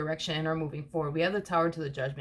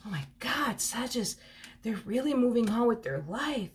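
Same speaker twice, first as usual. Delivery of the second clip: hushed disbelief, rising higher as it goes; mock shocked; 5 semitones higher than usual. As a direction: act out being stunned by the news. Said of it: "It's like they're shocked".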